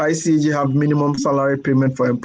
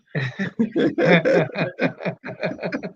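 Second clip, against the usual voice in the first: long, rippling real chuckle; a sustained giggle after reading out the question; amused laugh; 3 semitones higher, 3 dB quieter than usual. Real, not acted. Host amused by an exam fee transfer question, laughing.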